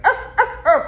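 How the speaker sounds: high, sharp imitated lapdog barks; mock dog barking. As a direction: yap like a little dog mid-story. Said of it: "and started barking".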